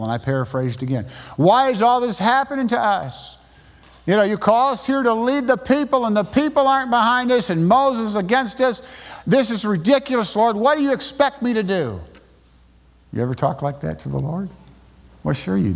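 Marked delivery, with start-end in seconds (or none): 1.4-12.0 high, whiny complaint to God; Moses complaining. Retold or imitated. Moses whining to the Lord about the people, high-pitched complaint. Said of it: "he's gonna complain a little bit".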